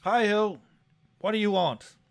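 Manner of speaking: loud, high, impatient demand; gruff voice. Acted. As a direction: bark it out impatiently. Gruff contact answers the ring.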